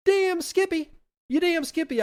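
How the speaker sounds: very high, excited exclamation; enthusiastic agreement. Acted. Teacher accepting the helpful fungus's offer, enthusiastic. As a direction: leap up in pitch with gleeful approval. Enthusiastic answer to the voiced helper.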